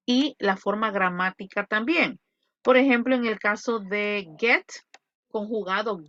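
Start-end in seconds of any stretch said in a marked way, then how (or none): none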